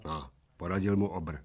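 ordinary delivery

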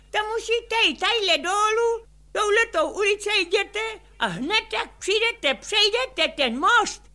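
high lady's voice giving directions, slowed at the bridge; high-voiced spa lady pointing the way. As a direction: play the helpful local woman, pitched high.

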